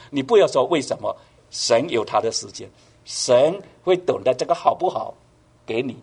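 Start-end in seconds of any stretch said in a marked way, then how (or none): none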